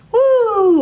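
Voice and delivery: high, loud cheer of woo; yelled woo. Shown as a demonstration. Teacher showing campers the cheer, loud whoop. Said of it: "you're supposed to yell".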